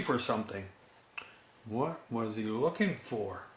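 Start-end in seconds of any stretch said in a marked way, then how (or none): none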